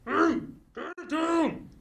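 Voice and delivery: loud, high, slow shout up at the ceiling; angry yell. Acted. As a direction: bark at the noisy neighbor to stop. Neighbor yells up at noise.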